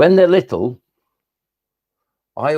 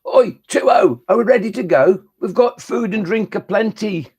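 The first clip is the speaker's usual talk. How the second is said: high-pitched, louder poem character; calling out impatiently; 9 semitones higher, 4 dB louder than usual. Acted. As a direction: call over to a mate, pitched high. Acted squabble in a poem.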